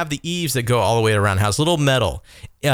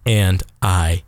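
dramatic slow admission; 7 semitones lower, much slower than usual. Real, not acted. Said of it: "I mean, guys, I lost it".

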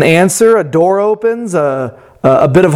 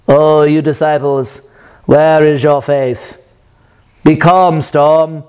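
put-on a light British accent, lower and unhurried; calm British Jesus; 4 semitones lower than usual. Preacher imitating Jesus rebuking the disciples, mild British accent. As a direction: lightly play a British Jesus calming the storm. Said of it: "British Jesus calmly and carefully".

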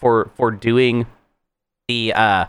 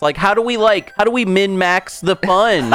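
higher, eager designer pitch, half-joking; mock designer voice; 6 semitones higher than usual. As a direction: play a giddy designer asking how to squeeze out fun. Mocks designer min-maxing.

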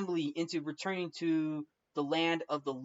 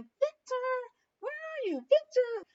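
very high calling voice; calling Emily. Retold or imitated. Emily searching for Victor, calling out high. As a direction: sing out his name like a lost bride searching.

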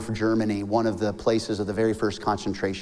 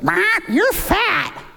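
very high, loud parrot squawk; 5 dB louder, much slower than usual. Acted. Parrot insults the man as he passes back, squawking. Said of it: "parrot goes".

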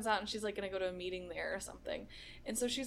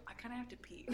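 quiet aside; 2 semitones higher, 11 dB quieter, faster than usual. Retold or imitated. Retelling own quiet remark.

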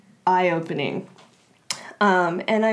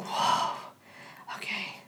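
whispered awe; 10 dB quieter than usual. Retold or imitated. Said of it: "I was just like".

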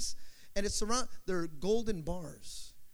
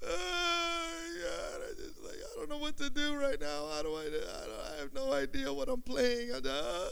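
high, overwhelmed cry breaking into a hushed gasp; overwhelmed cry to God; 6 semitones higher than usual. Retold or imitated. Drummer overwhelmed by God's power, crying out. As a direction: let it spill out, undone by the moment. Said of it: "you're like".

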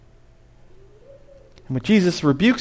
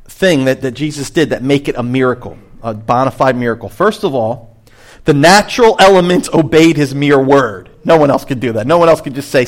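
usual speaking voice

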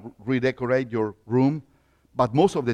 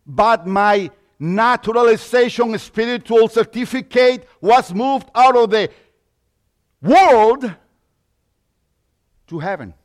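rising preaching; 7 semitones higher, 8 dB louder than usual. Acted. Preacher rises again.

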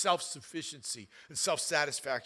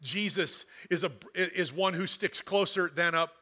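slow and louder, rising toward the end; building preaching; 3 semitones higher, 4 dB louder than usual. Acted. Pastor reassuring the church of God's love, slow and building. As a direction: press each promise home.

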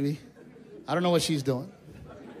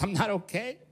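mock crying wife. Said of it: "My wife was crying. She goes".

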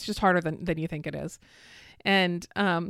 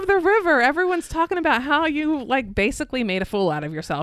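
mock-amazed teasing, higher and louder; playing it up; 6 semitones higher, 5 dB louder than usual. [name] teasing wife about the flip, mock-amazed and higher. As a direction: ham up the gossip to needle her. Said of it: "you're like playing it up".

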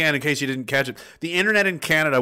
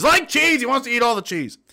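high, loud-starting comic outburst; mock outrage; 8 semitones higher, 3 dB louder than usual. Host teasing a chat member about cheese, mock outrage. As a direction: burst out high in mock indignation.

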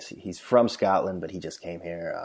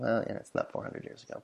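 inner thought; 3 semitones higher, 10 dB quieter than usual. Retold or imitated. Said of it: "And I'm thinking".

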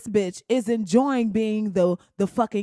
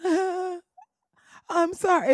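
mock apology; 9 semitones higher than usual. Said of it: "then it's like".

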